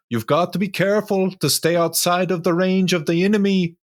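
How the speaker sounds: high, quick put-on character voice; Jarl of Whiterun. [name] cautions while striding through snow, Jarl of Whiterun voice. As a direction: warn them in a high, hurried character voice. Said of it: "you sound like the Jarl of Whiterun".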